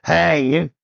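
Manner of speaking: imitated drunk, stretching the words; drunk heckler. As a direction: drag it out like a drunk in the back. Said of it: "a bad drunk at the zoo".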